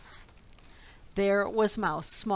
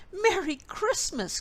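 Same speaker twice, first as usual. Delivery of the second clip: high, bright festive greeting; a small mouse crying out Merry Christmas; excited cry; 10 semitones higher than usual. A narrator's reading. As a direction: call it out with delight. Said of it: "cried Mouse".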